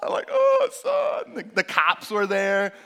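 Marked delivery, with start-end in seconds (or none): 0.3-1.3 relieved. Retold or imitated. Relieved father finds son.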